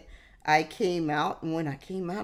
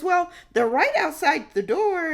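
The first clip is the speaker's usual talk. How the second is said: much higher and louder, pressing; insistent lady; 12 semitones higher, 6 dB louder than usual. Retold or imitated. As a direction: push the point. Lady insists paramedics are near.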